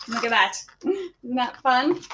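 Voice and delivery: plain reading voice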